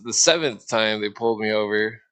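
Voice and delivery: usual speaking voice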